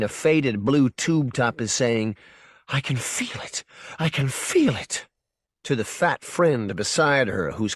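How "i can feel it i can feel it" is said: breathless rapture. A narrator's reading. Woman in ecstasy.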